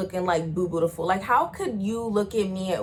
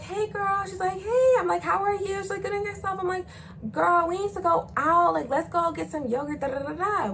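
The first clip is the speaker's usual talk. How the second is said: put-on high, singsong girly exchange; mock chirpy girlfriend call; fake-friendly phone chat; 10 semitones higher than usual. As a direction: keep it pitched up and phony-sweet.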